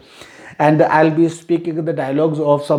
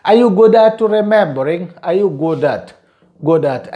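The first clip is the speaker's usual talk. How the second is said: ordinary delivery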